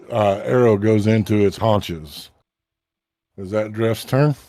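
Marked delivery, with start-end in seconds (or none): none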